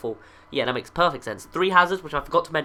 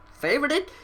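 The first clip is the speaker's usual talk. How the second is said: 1 questioning rise; 7 semitones higher than usual. Shown as a demonstration. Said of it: "'cause I said favoured as in past".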